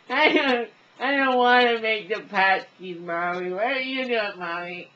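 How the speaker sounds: loud, high-pitched wail to Mommy; hammed-up bawling, very high; whining child impression. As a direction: whine at full volume like a protesting kid. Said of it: "I call this the challenge".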